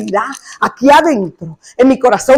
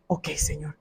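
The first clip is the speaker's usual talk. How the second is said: hushed surrender; 9 semitones lower, 20 dB quieter than usual. Retold or imitated. Quiet surrender to God.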